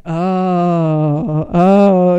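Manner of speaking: imitated priest's reaction. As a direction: imitate a priest slowly catching on, deep and long. Imitates priest's reaction to her news.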